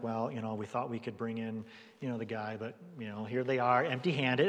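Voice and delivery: low, quiet, hesitant mumble of a caught-out minion; sheepish excuse. Retold or imitated. Guards sheepishly explaining to priests, low hesitant mumble. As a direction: shrink back and mumble the excuse softly. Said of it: "they're kinda like".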